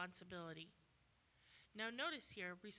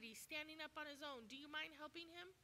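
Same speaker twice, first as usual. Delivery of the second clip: mock excited bystander; 6 semitones higher, 4 dB quieter than usual. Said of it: "So excited".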